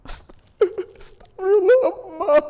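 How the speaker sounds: slow, high, tearful astagfirullah; child weeping for a dead mother; weeping prayer. Acted. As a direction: cry out for your mother's forgiveness. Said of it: "ingat ayah dan ibu".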